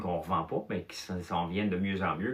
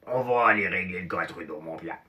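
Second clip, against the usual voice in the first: lightly put-on gruff voice; a hint of a rowdy drinking buddy; gruff friend voice. Gruff friend plotting to Pierre at the table. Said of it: "il parlait avec une grosse voix".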